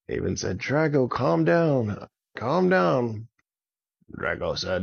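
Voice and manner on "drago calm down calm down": high-pitched urgent plea; urgent calling out. Acted. Avon calls to the raging dragon, urgent. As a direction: call up to someone out of control.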